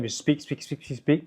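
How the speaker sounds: monotonous staccato demo; staccato rhythm. Shown as a demonstration. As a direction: rattle it off without pausing. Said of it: "just speak with staccato rhythm".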